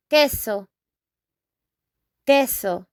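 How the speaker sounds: queso, KEH-so, hard k; Spanish queso. Shown as a demonstration. Teacher modeling cheese in Spanish, clear. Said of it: "and in Spanish".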